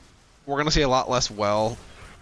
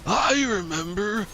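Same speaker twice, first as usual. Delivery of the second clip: put-on high, strained Hulk Hogan old man; 10 semitones higher than usual. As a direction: croak it like a grizzled grandpa. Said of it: "It's my old man voice".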